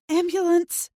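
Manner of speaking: high urgent cry for help; calling out. A narrator's reading. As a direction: call it out high and loud. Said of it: "I say".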